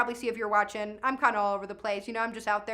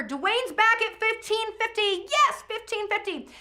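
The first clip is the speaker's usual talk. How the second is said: high, drawn-out auctioneer's cheer; excited bid call; 9 semitones higher, 3 dB louder, slower than usual. Real, not acted. Auctioneer cheers a bidder's return, excited. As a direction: hail the bid with real excitement.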